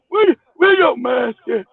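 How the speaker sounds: slow, drawn-out shouted demand; mock angry demand. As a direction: stretch each word like a mock scolding. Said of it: "I think he gonna say".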